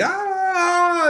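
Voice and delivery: very high mock wacky squeal; a quick zany puppet yelp. Shown as a demonstration. Showing teachers what wacky sounds like, a high squeal. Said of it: "I'm not wacky".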